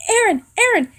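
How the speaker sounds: high, loud acted call; urgent calling out. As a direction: shout the name for attention. Hanji urgently calls to Aaron.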